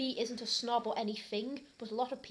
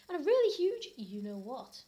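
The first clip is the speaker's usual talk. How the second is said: ordinary delivery, nothing marked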